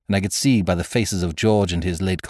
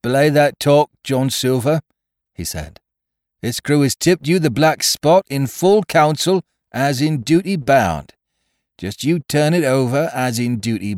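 challenging mutineer, raised voice; George; 8 semitones higher, 6 dB louder than usual. Acted. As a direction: stand up to the captain with formal defiance.

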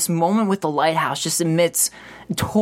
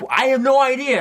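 high, loud innocent denial; playing dumb; 9 semitones higher, 4 dB louder than usual. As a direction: feign total ignorance. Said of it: "he could have just been like".

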